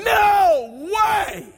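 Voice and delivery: high, loud drawn-out cry; mock protest shout. Preacher acting out what Ahimelech didn't say, shouted. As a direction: belt it out in exaggerated protest.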